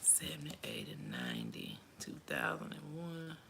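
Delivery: whispered counting; counting under breath. Real, not acted. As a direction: count it off to yourself.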